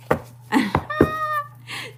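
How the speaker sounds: a bright, high burst of laughter. Real, not acted. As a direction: laugh high at your own joke. Laughs at her own joke.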